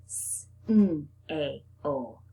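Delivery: Sn-a-o with each sound drawn out; split apart. Shown as a demonstration. Teacher breaks the word apart for the children. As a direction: stretch Sn-a-o into separate sounds. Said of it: "Break apart that sn".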